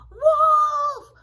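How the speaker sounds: very high, loud, drawn-out cry; storybook shepherd boy screaming for help; yelled alarm. A narrator's reading. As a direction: yell wolf with all your might. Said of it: "immediately yelled".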